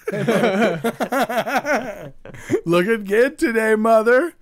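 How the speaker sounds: loud, high mock come-on; hammed-up guy hitting on women; catcalling guy.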